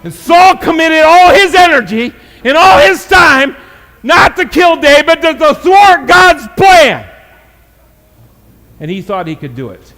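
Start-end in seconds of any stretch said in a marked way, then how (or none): 0.0-7.1 preaching at full force. Acted. Pastor driving home Saul's fight against God, preaching loud. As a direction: preach it out, rising to a shout.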